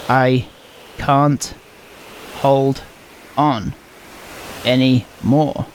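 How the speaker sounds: each word spaced out slowly; slow mouthed words. Acted. Naniko hanging on, mouthing words slowly. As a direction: mouth it one word at a time. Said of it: "moves her mouth slowly".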